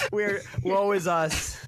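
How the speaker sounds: slow, hammed-up woe; mock lament. Mocking persecuted Christians, slow hammed-up lament. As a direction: moan theatrically about suffering.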